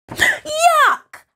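very high squeal of revulsion; horrified, drawn-out yuck; disgusted shriek. Acted. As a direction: shriek it out, stretching the words. Narrator voices disgusted discovery.

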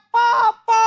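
put-on high, loud child's cry for help; acted frightened yell. Scared child calling father upstairs, acted high yell. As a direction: yell up to a parent on another floor. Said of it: "dia teriak panggil nama saya".

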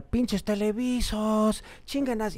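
grito burlón de la multitud, más agudo y lento; público gritando. Retold or imitated. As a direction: imita a la gente abucheando desde el público. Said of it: "nos empezaron a gritar". (el público le grita a la banda, imitado con grito agudo y lento)

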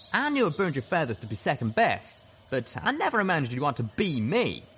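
heated accusation, pitched high; rising voice. A narrator's reading. Calamity accuses his double of envy, voice rising in anger. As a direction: let the indignation climb as you accuse. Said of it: "His voice was slowly rising".

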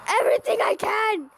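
high, strained urgent cry; desperate urgency. Real, not acted. Player throwing everything into the last defence, desperate cry. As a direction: throw it out with all you have.

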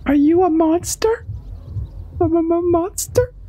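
put-on a timid child who can barely get the word out; scared little kid. The child nervously asks the frog if it's a monster, in a high stammering voice.

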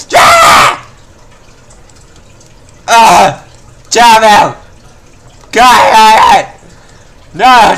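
furious gamer meltdown, yelling every word; real angry outburst at a failed surgery; real rage. Real, not acted. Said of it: "I don't think I ever raged this much".

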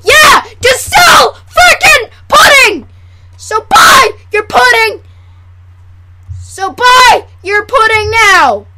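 high, slow, emphatic mock-ad yelling; shouted hard sell. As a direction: belt out each word to sell it. Mock commercial, ordering viewers to buy.

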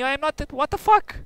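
high, loud outraged protest; indignant yell. Real, not acted. Accused and shot.

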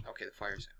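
breathy relieved whisper. Real, not acted. Relief after fire.

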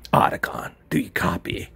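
low Snake voice, whispered end; low Snake call, fading to a whisper; Solid Snake voice. Acted. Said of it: "I gotta do a voiceover".

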